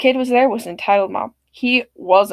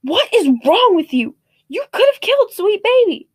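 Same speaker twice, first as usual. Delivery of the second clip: angry scolding; 9 semitones higher, 4 dB louder than usual. Retold or imitated. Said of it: "Cursing very intensively".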